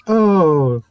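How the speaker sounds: a lightly voiced blind friend, high and slow; slow recognition. A lightly voiced blind friend realizing who is there, high and slow. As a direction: stretch it out like it just dawned on him.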